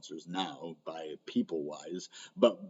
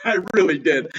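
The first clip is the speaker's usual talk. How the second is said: laughing, pitched up high; 9 semitones higher, 9 dB louder than usual. Real, not acted. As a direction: laugh it out, embarrassed. Laughs at his failed chart call.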